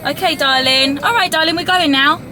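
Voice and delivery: high, loud cooing over crying. Real, not acted. Mum soothes her crying baby in the car, high cooing.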